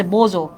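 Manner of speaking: low, soft বোজো for bonjour; French bonjour. Shown as a demonstration. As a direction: repeat বোজো quietly as a French greeting. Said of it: "উচ্চারণটা একটু কঠিন আছে".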